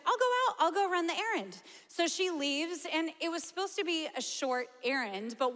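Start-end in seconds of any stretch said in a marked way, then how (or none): 0.0-1.6 high, bright volunteering mom; cheery mom voice. Retold or imitated. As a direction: chirp it like an eager mom.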